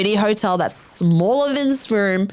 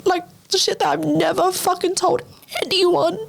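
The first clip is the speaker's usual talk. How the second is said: real choked-up voice, pitched much higher; emotional, near tears; 10 semitones higher than usual. Real, not acted. Telling friends' support after wanting to die, emotional. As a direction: fight back tears, pausing to get the words out. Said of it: "I'm getting emotional".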